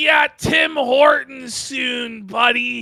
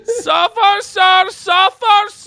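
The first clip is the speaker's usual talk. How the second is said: fluttering cries for help; 7 semitones higher than usual. Pretend. Caricature voice continues.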